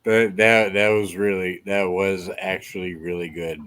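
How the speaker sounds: talking around a cigarette; words round a lit cigarette; cigarette in mouth. Real, not acted. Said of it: "let me light this cigarette".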